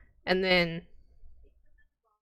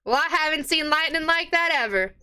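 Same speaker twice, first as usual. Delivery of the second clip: higher, lightly voiced barmaid; a worried tavern keeper's voice, pitched up; 6 semitones higher than usual. Barmaid warns patrons about the priest, lightly higher.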